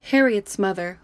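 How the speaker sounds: ordinary delivery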